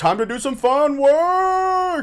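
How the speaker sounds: high, drawn-out excited holler; gleeful rallying call; mock hype. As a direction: whoop it up, stretching the last word high. Mock hype about free day.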